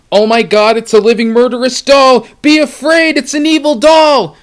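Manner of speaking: high, loud, frantic alarm; panicked amygdala. Pretend. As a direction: shriek out a panicked warning. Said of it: "this is what they said".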